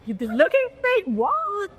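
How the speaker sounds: high-pitched mock disbelief; incredulous. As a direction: imitate a baffled man put on the spot. Director's startled reply acted out.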